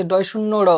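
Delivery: ড় in ডয় শূন্য র flicked with a light tap; demo of flap. Shown as a demonstration. Teacher demonstrating the flap for the class. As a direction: give ড় one quick tap. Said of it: "যেন একটা টোকা দিচ্ছে".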